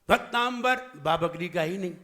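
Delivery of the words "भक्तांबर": भक्तांबर with an added m and b, "bak-taam-bar"; misread. Shown as a demonstration. Preacher asks why it was misread, wrong b in भक्तांबर. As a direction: close the middle of भक्तांबर into a firm "mb". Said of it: "फिर आपने गलत क्यों पढ़ दिया".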